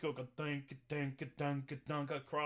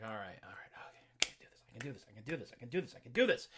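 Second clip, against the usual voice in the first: Jack psyching himself up; 8 semitones lower, much faster than usual. Retold or imitated. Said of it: "Jack's like".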